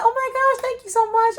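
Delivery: high, loud gushing thank-you. Retold or imitated. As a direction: gush like the compliment made your day. Gushing thanks to complimenting girl.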